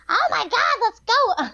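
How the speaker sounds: high, pushy goose voice; honking bossy goose. Shown as a demonstration. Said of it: "it was a very good goose voice".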